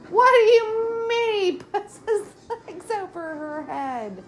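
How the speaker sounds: high, cracking cries of disbelief; squeaky indignant outburst at a movie; outraged disbelief. Real, not acted. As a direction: yelp it at the screen, appalled.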